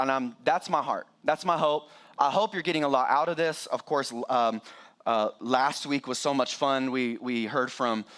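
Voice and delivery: ordinary talk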